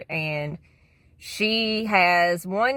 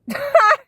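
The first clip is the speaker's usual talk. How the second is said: short, high, loud laugh; one sudden loud "ha"; abrupt high bark of laughter; burst of laughter; 8 dB louder than usual. Real, not acted. Scoffs after mocking the mom.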